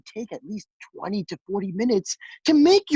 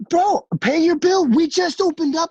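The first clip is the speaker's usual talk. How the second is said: exasperated demand; 10 semitones higher, 7 dB louder than usual. Retold or imitated. Restaurant cook to walkout diners, exasperated shout. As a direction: shout it in pitched-up disbelief.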